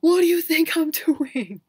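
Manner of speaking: incredulous, half-laughing comeback; exasperated retort. Retold or imitated. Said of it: "the whole time I'm like".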